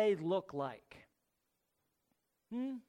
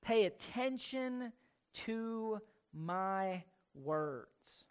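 much softer and drawn right out; slow, hushed emphasis; 6 dB quieter, much slower than usual. Shown as a demonstration. Preacher quietly urging the congregation to listen, slow and soft. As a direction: let each word sink in, quietly and slowly. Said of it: "is what he's saying again and again".

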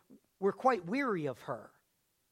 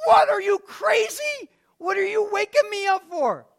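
annoyed wife; 11 semitones higher, 11 dB louder than usual. Retold or imitated. Mother woken at night, snapping at husband. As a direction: scold him for waking her.

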